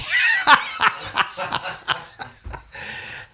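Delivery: high, breaking-up laughter; long laugh. Real, not acted. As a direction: laugh helplessly at the memory. Laughs at his own memory.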